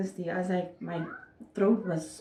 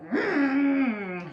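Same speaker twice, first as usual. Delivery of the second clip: pained cry hum; 5 semitones higher than usual. Retold or imitated. Reenacting pushing in labour.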